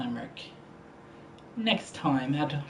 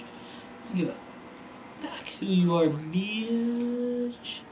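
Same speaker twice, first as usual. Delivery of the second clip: mock trash talk; 4 semitones higher, 4 dB quieter, slower than usual.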